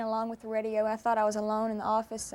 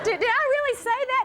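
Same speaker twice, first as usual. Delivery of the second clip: high-pitched, incredulous imitation; retold surprise; 12 semitones higher, 4 dB louder than usual. Singer retelling the friend's reaction, high mock surprise. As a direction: act out her disbelief, pitched way up. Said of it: "and she said".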